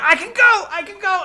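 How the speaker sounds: high, loud burst of mock glee; hammed-up elated cry; rushing excitement. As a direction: burst in with the good news. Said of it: "So Balaam comes rushing".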